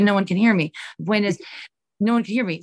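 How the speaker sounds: ordinary delivery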